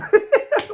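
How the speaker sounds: loud high burst of laughter. Real, not acted. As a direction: crack up loudly. Laughing at own dare.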